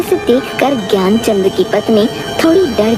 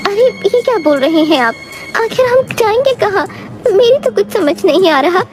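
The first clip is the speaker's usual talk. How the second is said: lightly voiced wife, higher than the narration; puzzled wife; 10 semitones higher than usual. Wife suspecting her husband hides something, puzzled. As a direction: question him as a doubtful wife.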